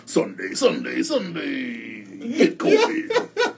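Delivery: hammed-up event promo, drawn out and climbing high at the end; mock advertisement. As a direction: parody a hype ad, stretching the last words. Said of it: "That is not an advertisement".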